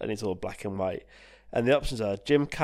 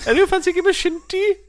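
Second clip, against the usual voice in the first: high, loud, quick mock call; hammed-up eager player; playful acted invitation; 9 dB louder, faster than usual. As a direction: shout a silly high-pitched invite. Acting out players inviting friends.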